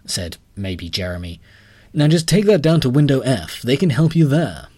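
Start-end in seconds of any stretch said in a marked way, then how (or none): 1.9-4.7 high, loud clerk voice; bright official bank clerk. A narrator's reading. Narrator voices the clerk, loud and high.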